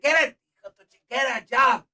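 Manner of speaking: loud, high mock dad yelling; a hammed-up dad shouting to get a job; imitating his dad.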